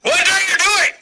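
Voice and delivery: high-pitched alarmed shout. Acted. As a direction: yell it in a high squeak. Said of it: "He's like".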